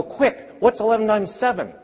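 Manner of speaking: quick-fire question. Retold or imitated. Teacher speeding up the quiz.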